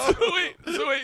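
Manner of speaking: high, loud restart through laughter; cracking up while talking; laughing. Real, not acted. Resuming story over laughter.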